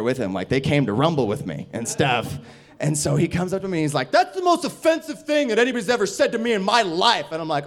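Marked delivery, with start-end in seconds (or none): 4.1-7.3 high, loud mock indignation; imitated outraged kid. Offended kid confronts the comedian, high indignant complaint. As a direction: protest it as a deeply offended kid.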